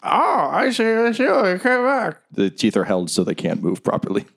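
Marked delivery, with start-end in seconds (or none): 0.0-2.2 very high character voice; reply in a squeaky, high-pitched voice; squeaky put-on voice. Game master answers as a character, squeaky high voice.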